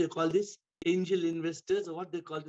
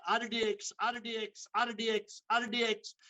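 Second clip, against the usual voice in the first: angry; 6 semitones higher than usual. Acted. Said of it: "angry, upset".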